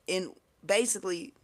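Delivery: ordinary talk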